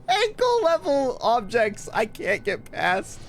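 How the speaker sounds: high, slow, incredulous; mock outrage at the obstacle; exasperated. Real, not acted. Exasperated mockery of game.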